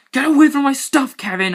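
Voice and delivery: high, loud angry yell; angry shout. Acted. Boy shouting at his brother, high and angry. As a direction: yell at him to get off your stuff. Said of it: "I said angrily".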